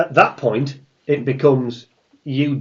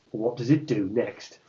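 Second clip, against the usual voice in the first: normal talking voice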